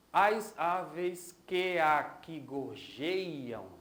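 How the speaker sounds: "As aves que aqui gorjeiam" very slow, syllable by syllable; escansão do verso. Shown as a demonstration. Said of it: "Vamos dar uma olhada?". ("As aves que aqui gorjeiam" bem devagar, sílaba por sílaba)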